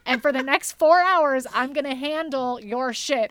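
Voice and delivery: hamming up a pushy tow truck driver; mock tow truck driver. Friend jokingly voicing a tow driver taking charge, loud and brash.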